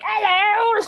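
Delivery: very high, silly British falsetto; hammed-up comic British screech; Monty Python. Shown as a demonstration. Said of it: "sound like the dudes from Monty Python".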